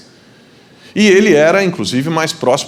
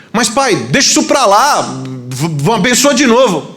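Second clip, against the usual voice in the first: high-pitched begging son; dramatised, high insistent plea; acted desperate plea; 8 semitones higher, 4 dB louder than usual. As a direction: plead urgently with your father, stumbling. Son begs father for blessing.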